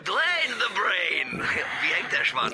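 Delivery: drawn-out, high, mocking name call; mocking greeting. Caller teasing Dwayne over the phone, drawn out and mocking. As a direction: sing his nickname out to tease him.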